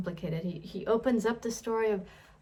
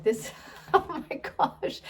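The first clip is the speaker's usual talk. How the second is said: amused laugh through the words; delighted chuckle while speaking; 8 semitones higher, 3 dB louder than usual. Retold or imitated. Jesus laughing at Nathaniel, amused. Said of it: "Jesus kinda laughs at him".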